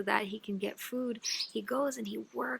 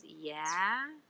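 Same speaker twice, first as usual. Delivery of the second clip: gruff miser; 5 semitones lower, 5 dB quieter than usual. Acted. Miser answers door gruffly.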